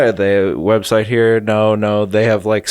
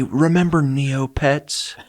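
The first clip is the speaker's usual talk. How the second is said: nostalgic mock; 4 semitones higher, slower than usual. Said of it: "I say".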